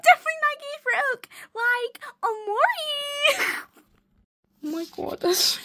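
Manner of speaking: very high, squeaky voice; squealing amusement. Real, not acted. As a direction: squeal it out, stretching the last name. Breaking up over own drawings.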